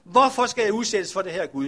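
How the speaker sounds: loud anguished cry at heaven; crying out to God. Acted. Leper shaking his fists at heaven, loud anguished cry. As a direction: shout the question up at the sky. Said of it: "og råbt".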